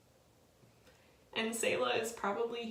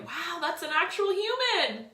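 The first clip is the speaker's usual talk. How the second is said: awestruck exclaim; 12 semitones higher, 10 dB louder than usual. Retold or imitated. Retold awe at the ultrasound.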